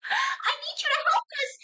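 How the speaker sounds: very high, squealed puppet voice; puppet scream. Acted. DM answers a request for a puppet scream, high squeal. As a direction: shriek it in a tiny falsetto without much volume. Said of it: "Can we get a puppet scream".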